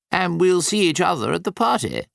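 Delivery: a hint of Nicholas, gently pushing; Nicholas's voice. A narrator's reading. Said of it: "said Nicholas".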